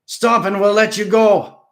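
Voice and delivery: very loud, high shout; roaring plea. A narrator's reading. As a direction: yell it out desperately. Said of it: "Gannon Haast roared".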